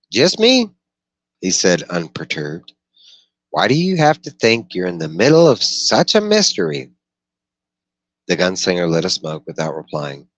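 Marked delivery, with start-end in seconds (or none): 0.1-0.7 high, loud raised voice for the dweller; Brown's voice. Acted. Dweller Brown questioning the gunslinger by the fire, high and loud. 3.5-6.9 high, loud raised voice for the dweller; Brown's voice. Acted. Dweller Brown questioning the gunslinger by the fire, high and loud.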